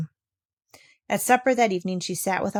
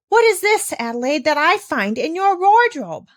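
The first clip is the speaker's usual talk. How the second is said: much higher, louder shocked demand; horrified exclamation; 9 semitones higher, 7 dB louder than usual. Acted. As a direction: recoil at what you found. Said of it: "she exclaimed".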